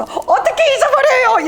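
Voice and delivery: high, slow, laughing exclamation. Retold or imitated. Barista greeting the returning pastor, laughing high. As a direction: laugh it out in disbelief. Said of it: "그 자매가 막 웃으면서".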